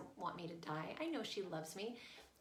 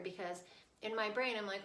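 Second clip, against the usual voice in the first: usual speaking voice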